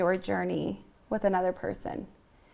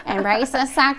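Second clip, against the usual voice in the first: louder, higher mock husband voice; husband's voice; 5 semitones higher, 7 dB louder than usual. Hearing husband's voice when wanting to crawl out, mock husband voice. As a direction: imitate a husband's blunt order, loudly. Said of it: "I hear his little freaking voice".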